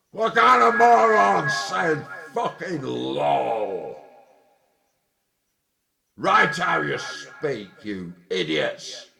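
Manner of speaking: loud, slow, scornful shout; angry outburst. Real, not acted. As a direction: hammer each word at the fools. Ranting at people who say lol.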